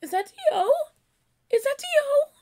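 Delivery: high and loud, building disbelief; excited shriek. Real, not acted. Fan shrieking as she recognises D O.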